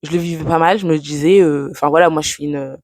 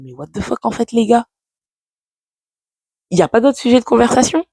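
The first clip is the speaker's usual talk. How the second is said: retold disbelief, pitch climbing high; incredulous; 7 semitones higher than usual. Single woman reacting to friends' questions about her love life, incredulous. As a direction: replay an exasperated, higher-pitched challenge to the group.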